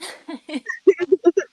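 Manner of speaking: high, loud laugh; laughing at herself. Real, not acted. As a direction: laugh off the age. Laughing at her age.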